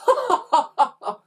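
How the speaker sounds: high, loud acted cackle; villainous laughter. A narrator's reading. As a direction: cackle at your own boast. Villains laugh at their boast.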